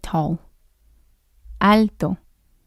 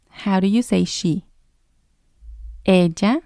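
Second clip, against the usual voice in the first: ordinary talk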